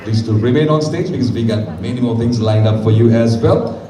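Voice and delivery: ordinary talk, nothing marked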